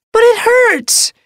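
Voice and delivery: very high, slow kitten whine; whiny protest. A narrator's reading. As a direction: protest like a hurt kit. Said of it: "the apprentice protested".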